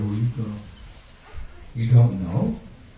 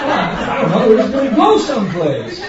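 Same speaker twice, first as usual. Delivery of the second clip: laughing dad; 7 semitones higher, 4 dB louder than usual. Retold or imitated. Father laughing, no plan.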